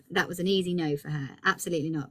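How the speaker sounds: usual speaking voice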